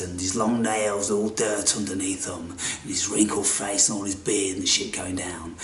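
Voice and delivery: breathy storyteller hush, no voice; hushed whisper. A narrator's reading. Storyteller whispers to viewers about the wanderer, hushed. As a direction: whisper it like a secret about the old man. Eerie storytelling of ragged old man.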